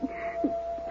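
a sudden startled intake of breath; sharp frightened gasp. Acted. Widow startled by something running through the room, gasping.

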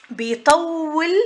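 بيطول with its long vowel drawn out slowly; stretched word. Shown as a demonstration. Teacher explaining madd, stretching بيطول to show it. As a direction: lengthen the و in بيطول to act out the word. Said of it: "يعني اللي طولنا فيه".

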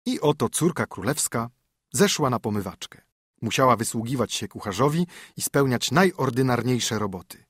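ordinary delivery

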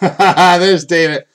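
laughing it out at a buddy; high, loud laugh through the name; chuckling tease at David. Real, not acted. Teasing friend over a hit.

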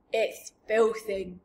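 slow, loud giant splutter; disgusted giant with a mouthful; mouth-full splutter. A narrator's reading. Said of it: "he spluttered, speaking with his mouth full".

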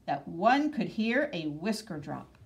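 ordinary delivery

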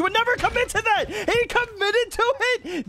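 high-pitched disbelief, stretching out at the end; astonished gamer squeal; incredulous outburst. Real, not acted. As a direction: marvel at it, pitch climbing. Amazed at opponent's move.

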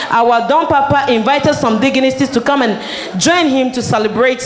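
normal talking voice

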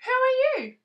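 high curious tadpole. Acted. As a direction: ask up high, small and curious. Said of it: "said Tadpole".

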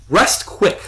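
high, annoyed command; sharp, high demand. A narrator's reading. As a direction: order it impatiently, pitched well up. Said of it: "the voice demanded".

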